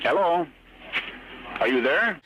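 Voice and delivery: normal talking voice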